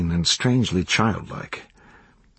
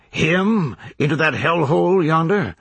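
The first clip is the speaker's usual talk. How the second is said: fervent preacher; 7 semitones higher than usual. A narrator's reading. Reverend preaching to his tent congregation, fervent. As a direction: preach it with rising indignation.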